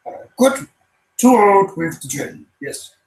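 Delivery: high, strained Yoda croak; Yoda impression. Streamer shows his Yoda impression to the chat. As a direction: squeeze it up high like old Yoda. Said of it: "So I can go".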